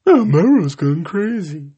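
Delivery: high, prim mock gossip; mock a fussy onlooker; mocking prissy voice. Mocking critics again.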